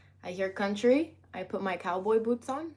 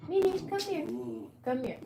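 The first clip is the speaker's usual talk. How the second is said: calling the dog; 6 semitones higher, 3 dB louder than usual. Real, not acted.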